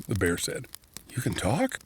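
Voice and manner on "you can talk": amazed question. Acted. Orson amazed at talking bear.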